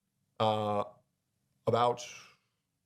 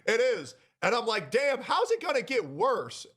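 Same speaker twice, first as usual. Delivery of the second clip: rueful laugh; 11 semitones higher than usual. Real, not acted. Laughing at his own misfortune.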